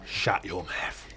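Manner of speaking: low, slow, quiet London geezer; South East London accent. Retold or imitated. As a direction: growl it slowly in mock cockney. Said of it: "it's done like South East London".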